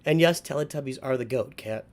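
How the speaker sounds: ordinary delivery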